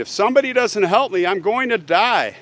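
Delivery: high, urgent retold plea; calling out. Wounded woman calls out to anyone who might help, urgent plea. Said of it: "calling out".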